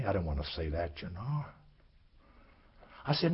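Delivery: normal talking voice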